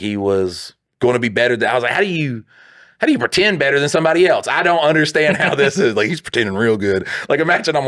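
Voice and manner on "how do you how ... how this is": raised pitch, incredulous questioning; lightly exasperated, higher-voiced disbelief; mock bafflement. Man baffled by praise for pretend play, mock incredulous. Retells his baffled reaction.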